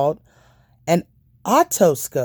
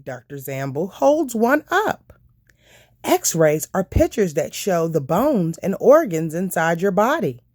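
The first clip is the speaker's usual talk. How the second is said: usual speaking voice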